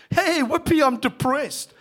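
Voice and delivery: loud, high, cheery whoopee; mock cheer. Shown as a demonstration. Preacher mocking cheer over bad news, loud and bright. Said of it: "you wouldn't say it like that".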